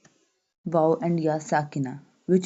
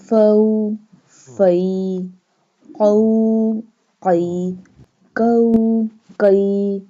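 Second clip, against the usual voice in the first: loud, higher spelling-out drill for the class; syllable drill; 3 semitones higher, 7 dB louder, much slower than usual. Shown as a demonstration. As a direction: chant each letter, vowel and syllable for students to repeat.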